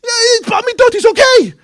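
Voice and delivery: high, loud mock indignation; a shocked onlooker mocking the rebbe's words; incredulous outrage. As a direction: act scandalized for the crowd.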